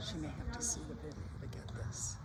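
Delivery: soft whispered remark to the side; whispered aside. Real, not acted. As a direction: whisper it so the rider can't hear. Aside to the camera, under breath.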